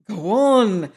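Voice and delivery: put-on a light cry of an old soldier egging him on; cried out. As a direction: urge him on with a lifted voice. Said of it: "cried my military friend".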